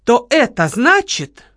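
slow, loud ominous threat. Acted. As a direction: lean on это with menace. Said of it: "начала Лола зловеще".

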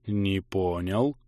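very slow, drawn-out "Не понял", rising at the end; slow, puzzled. A narrator's reading. Player slowly taking in the merchant's change, drawn out. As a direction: stretch "Не понял" out, baffled. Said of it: "медленно произнёс игрок".

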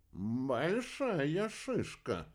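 slow, thoughtful character voice; pondering. A narrator's reading. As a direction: stretch each word out while thinking it over. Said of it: "подумав, произнёс Иха".

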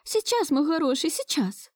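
anxious lamenting. A narrator's reading. Grandmother hurrying back to her grandson, lamenting. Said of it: "Причитала она".

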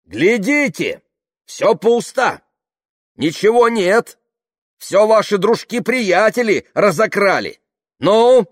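high, loud, angry exclamations; pressing, aggressive. A narrator's reading. Tamara showing the crowd the empty van, aggressive shouting. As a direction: advance on them shouting. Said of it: "наступала она".

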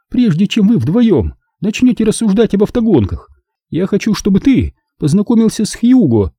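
high-pitched, mildly bossy hostess; imperious woman. A narrator's reading. Zelda steers Rick toward another guest, mildly imperious. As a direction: take charge of the talk, voice held high. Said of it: "властно вмешалась Зельда".

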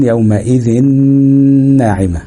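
"yawma'idhin-naa'ima" with a stretched n; noon merging. Shown as a demonstration. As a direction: hold a long nasal n from يومئذ into ناعمة. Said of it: "مثاله مع النون".

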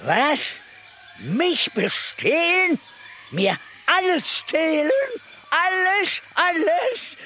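outraged disbelief, building; rising indignation. Acted. (sich empört hochschraubend)

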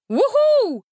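high loud whoop; a ringing high whoop; shout. A narrator's reading. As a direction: call out, bold and sudden. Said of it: "крикнул он".

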